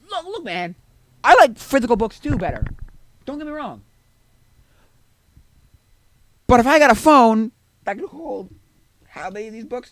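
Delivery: high, loud exasperated protest; heated pleading. Retold or imitated. Acting out a heated argument.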